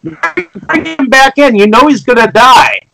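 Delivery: high-pitched yell without much volume. Acted. Viewer yelling at the characters to save him, urgent. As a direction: protest high and urgent, as if at the screen. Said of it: "you're, like, yelling at, at them".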